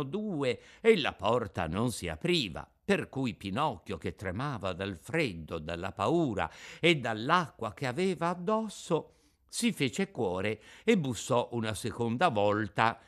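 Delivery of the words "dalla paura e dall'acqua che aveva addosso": plain reading voice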